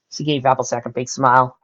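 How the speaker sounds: plain reading voice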